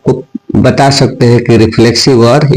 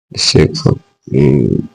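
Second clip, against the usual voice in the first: slow dictation; 10 semitones lower, 5 dB quieter, much slower than usual. Shown as a demonstration. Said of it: "dictation continues, trailing off low".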